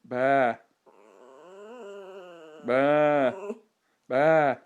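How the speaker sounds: sheep bleat. Shown as a demonstration. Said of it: "Say like a sheep".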